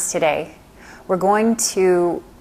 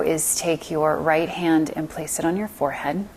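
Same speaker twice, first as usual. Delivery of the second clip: ordinary delivery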